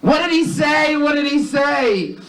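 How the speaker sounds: very high-pitched screech of a wife; hammed-up high wifely yell. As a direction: yell it in a piercing falsetto, dragging the end. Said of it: "His wife yells out".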